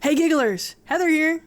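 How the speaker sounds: ordinary delivery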